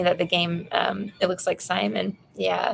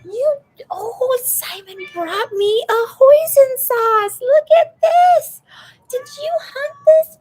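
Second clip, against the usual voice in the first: talking to a pet; 6 dB louder than usual. Real, not acted. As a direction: gush over the cat in a high voice. Cooing to cat Simon.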